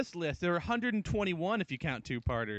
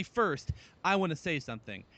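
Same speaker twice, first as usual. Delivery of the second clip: normal talking voice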